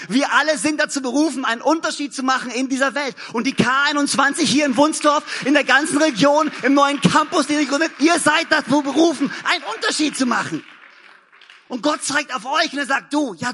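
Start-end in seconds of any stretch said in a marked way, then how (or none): 0.1-10.6 loud, rousing preacher's call to the church; full-voiced pulpit proclamation; preaching at full force. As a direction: lift the room, call them to make a difference. Preaching climax to the congregation.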